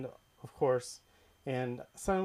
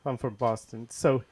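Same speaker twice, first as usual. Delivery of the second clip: usual speaking voice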